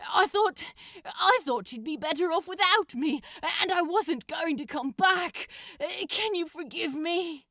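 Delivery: loud, tearful, breathless babble to the angel; frantic demon pleading. Acted. Crowley pours out his frantic apology to Aziraphale, loud and distraught. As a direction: blurt it out loud, half panicked and sorry.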